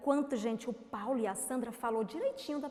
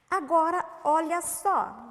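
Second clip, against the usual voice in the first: loud call for attention; 6 semitones higher, 10 dB louder, slower than usual. Real, not acted. Teacher calls class's attention.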